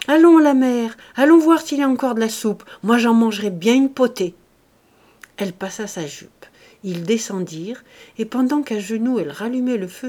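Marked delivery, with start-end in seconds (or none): 0.1-4.4 much louder, higher call, fast; shouting. A narrator's reading. Farmer calls to his wife as if she were deaf, loud. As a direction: call out cheerily. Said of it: "il cria".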